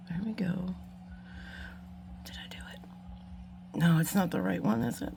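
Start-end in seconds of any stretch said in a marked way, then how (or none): 0.1-2.8 hushed hopeful whisper. Real, not acted. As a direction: barely breathe the words. Whispered while concentrating on task.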